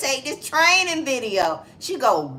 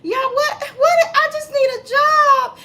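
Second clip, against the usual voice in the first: high, louder crying plea; a hammed-up tearful plea, pitched up; mock boo-hooing; 5 dB louder than usual. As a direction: imitate her sobbing desperately. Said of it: "she just started boo-hooing and crying".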